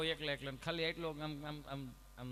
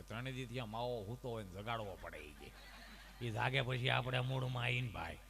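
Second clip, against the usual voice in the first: put-on old villager; 7 semitones lower than usual. Old man explains his mawa.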